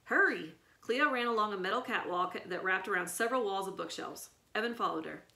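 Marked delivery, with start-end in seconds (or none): 0.0-0.5 urgent. A narrator's reading. Cleo urging escape.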